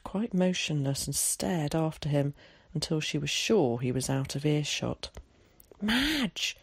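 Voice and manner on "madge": calling out. Acted. Woman calls out to neighbour.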